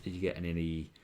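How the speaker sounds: ordinary delivery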